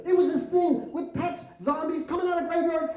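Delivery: put-on high, loud panicked child; hammed-up child's outburst; frightened boy. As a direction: shriek the explanation like a scared kid. Frightened boy tells his father.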